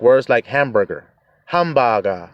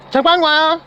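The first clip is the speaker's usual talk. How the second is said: high calling-out mother voice; 4 dB louder than usual. Retold or imitated. Korean mother calling her son home, high call-out. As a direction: call the son's name from across the house. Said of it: "the mother's like".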